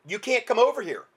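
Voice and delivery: ordinary talk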